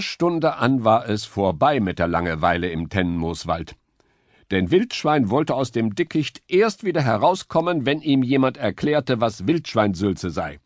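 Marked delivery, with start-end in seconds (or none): none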